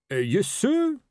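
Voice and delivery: high, hesitant sergeant voice; uncertain. A narrator's reading. Sergeant answering his captain's cheery greeting, uncertainly. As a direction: answer the boss without any confidence. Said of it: "said Fred Colon uncertainly".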